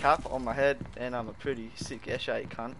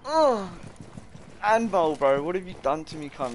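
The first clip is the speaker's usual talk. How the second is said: loud complaint; 5 semitones higher, 6 dB louder than usual. Real, not acted.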